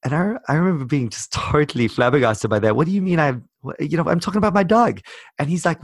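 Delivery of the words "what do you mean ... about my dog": higher, quicker, incredulous protest; retold bafflement. Retelling his stunned reply to the American roommate, incredulous. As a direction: protest in disbelief, pitched up.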